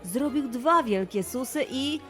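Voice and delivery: ordinary delivery, nothing marked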